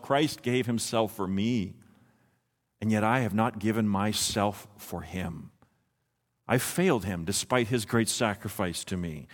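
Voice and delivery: low, soft self-reproach; remorseful thought. Acted. Preacher voicing a communicant's thoughts at the Lord's Supper, quietly remorseful. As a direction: confess it inwardly, low and subdued. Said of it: "you think to yourself".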